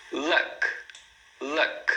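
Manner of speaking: LUCK stopped on the K sound; one-syllable pronunciation. Shown as a demonstration. Teacher models luck for students, correct pronunciation. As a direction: say "luck" with no vowel after the K. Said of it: "uma sílaba".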